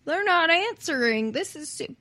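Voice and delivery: high, abandoned stab at Brooklyn; attempted Brooklyn accent. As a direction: start an amateur Brooklyn impression. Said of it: "like, Brooklyn".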